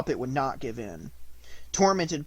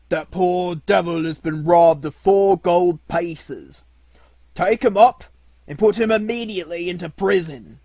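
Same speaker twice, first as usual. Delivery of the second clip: stern judge's order; 4 semitones higher, 10 dB louder than usual. Acted. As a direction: bellow the sentence from the bench. Judge orders Pinocchio jailed.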